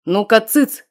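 громкий командный окрик; осадить всех одним словом; властный окрик. A narrator's reading. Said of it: "скомандовал властный голос". (властный главарь громко обрывает галдёж)

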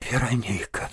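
said with a soft, low sigh; sighed name. A narrator's reading. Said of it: "вздохнул он". (со вздохом)

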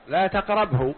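usual speaking voice

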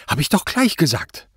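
high, indignant outburst; agitated protest. A narrator's reading. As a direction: blurt it out, voice jumping up. Suspect jumps up, protesting. (aufgeregt herausgeplatzt)

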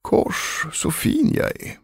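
soft, airy self-admiration; preening to himself. A narrator's reading. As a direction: think it admiringly, half under the breath. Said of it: "kråmade sig framför spegeln och tänkte". (självbelåtet, tyst för sig själv)

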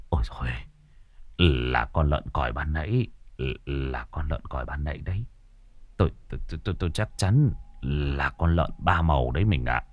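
low, soft relieved husband; husband's quiet stammering relief; relieved sigh. A narrator's reading. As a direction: breathe out after the fright. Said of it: "Hai ông bà thở phào".

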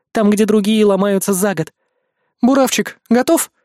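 high, firm, insistent noblewoman; commanding countess. Acted. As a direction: press the point, haughty and firm. Countess voiced in story.

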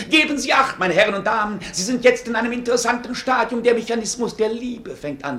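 laut ausrufender Schausteller, die Menge anlockend; marktschreier. Acted. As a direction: wie ein Jahrmarktsausrufer anpreisen. Barker calls for attention. (Valerio preist dem Hof die Automaten an, laut ausrufend)

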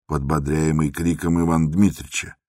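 plain reading voice

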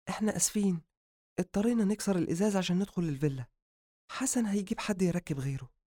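high, soft female voice; softly apologetic female character; apologetic woman. Acted. Sister apologizes to patient.

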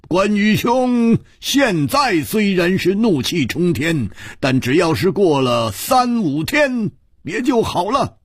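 put-on high, projected old man's call; calling from a distance. A narrator's reading. Old man calling back from the stone steps, high carrying voice. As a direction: throw the words back while walking away. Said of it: "他已经走得很远了".

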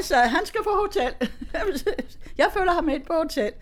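high voice broken by giggles; amused imitation, laughs between phrases; laughing retelling. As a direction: retell the protest while laughing. Laughing through son's retold objection.

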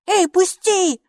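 high, indignant protest. A narrator's reading. Little girl pushed behind her brother, indignant. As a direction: protest at being held back. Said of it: "возмутилась Майки".

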